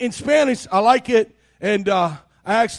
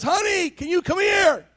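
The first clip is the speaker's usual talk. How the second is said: wife calling; 6 semitones higher, 4 dB quieter than usual. Retold or imitated. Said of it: "your wife says".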